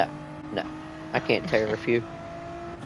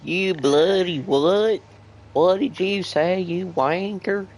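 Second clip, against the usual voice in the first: higher, slowed cockney-style mock outrage; mock British accent; 6 semitones higher than usual. Teasing co-driver answers in a mock British accent. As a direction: put on a British accent and play offended. Mocking British insult retort.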